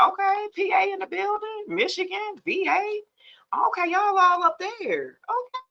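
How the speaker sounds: high, bright hype-up of each city; high-pitched greeting of every viewer; excited shout-outs. Real, not acted. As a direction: call out each place like a live host.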